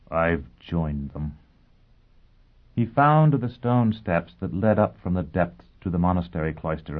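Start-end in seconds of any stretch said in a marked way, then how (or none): 0.1-1.4 despairing mumble. A narrator's reading.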